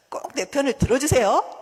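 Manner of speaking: loud, pleading plea with a rise on 들어주세요; pleading request. Shown as a demonstration. 하나님께 내 편 들어달라 조르듯 큰 소리로. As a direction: beg God loudly, ending 들어주세요 on a rise. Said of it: "이렇게 하란 말이에요".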